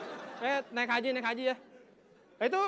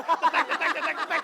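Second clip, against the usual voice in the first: clicky cetek cetek clipper sounds; playful clipper noise imitation; imitating nail clippers; 5 semitones higher, 9 dB louder than usual. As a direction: mimic the snap of nail clippers. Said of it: "gunting kuku kedengeran suaranya".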